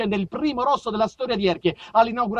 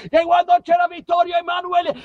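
put-on high, loud old-timer grumble; hammed-up old general; nostalgic old general's outburst; 12 semitones higher, 7 dB louder than usual. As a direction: bark about the good old days.

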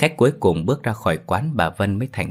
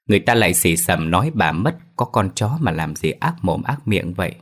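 ordinary delivery, nothing marked

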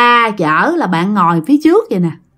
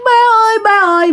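mock crying call; 11 semitones higher, much faster than usual. Fallen child crying out to his dad, imitated wail. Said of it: "bạn mới khóc".

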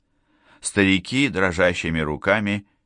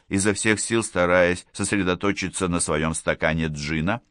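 plain reading voice

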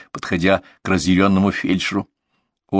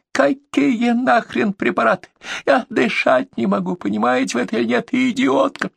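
shrieking. A narrator's reading. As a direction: scream insults. Caller screaming.

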